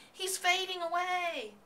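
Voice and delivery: high, slow alarmed cry. A narrator's reading. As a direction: cry out as the pig fades. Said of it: "screamed Fern".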